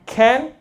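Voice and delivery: "Can" lifted high as a question. Shown as a demonstration. Teacher demos Singlish "Can" as a question, rising. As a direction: ask "Can" like checking permission. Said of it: "可以吗？".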